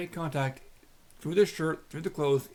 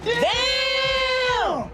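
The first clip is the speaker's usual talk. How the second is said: shocked shout; 10 dB louder than usual. Real, not acted. Commentator reacts to officer dragged.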